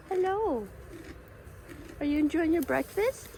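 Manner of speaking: sweet high animal-greeting voice; pet talk. Real, not acted. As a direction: coo softly at a grazing animal. Greeting an animal eating nearby.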